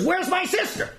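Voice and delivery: high-pitched indignant demand; high, urgent challenge; demanding outburst. Retold or imitated. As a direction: act out a bold brother confronting an officer. Marching in to confront the commandant.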